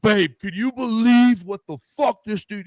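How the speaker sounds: high, loud riled-up shouting; hammed-up rant to a partner; venting to his partner, loud and high; mock angry outburst. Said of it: "And I'm over here like".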